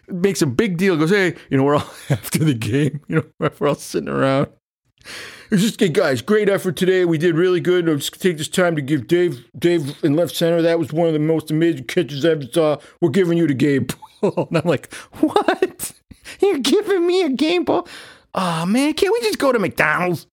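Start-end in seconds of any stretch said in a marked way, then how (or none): 1.1-14.0 hammed-up upbeat coach voice, higher; mock pep-talk from the coach; cheesy rec-league coach praising the team; coach's team speech. 15.2-20.3 put-on much higher, whiny kid voice; squeaky childish surprise at the game ball; mock kid reply. His own mock-childish reply.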